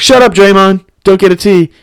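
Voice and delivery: high, urgent mock call to a player; retold calling out.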